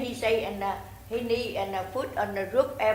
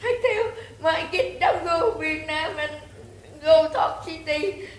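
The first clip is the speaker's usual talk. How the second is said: high-pitched tearful telling; real crying, voice climbing high; 6 semitones higher than usual. Real, not acted. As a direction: push the words out through real tears. Crying while recounting her troubles.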